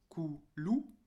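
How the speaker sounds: "coulou" with an ou after the L; wrong added vowel. Shown as a demonstration. Teacher showing what was not said, added final ou. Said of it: "j'ai pas dit".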